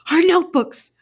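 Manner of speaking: high, loud anguished wail. Acted. Early wailing over the stolen notebooks. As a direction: cry out over the lost notebooks. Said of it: "Early wailed".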